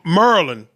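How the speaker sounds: "Maryland" said as "Murlin"; local Maryland pronunciation. Shown as a demonstration. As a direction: drop the middle of "Maryland": MUR-lin. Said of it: "They say Maryland".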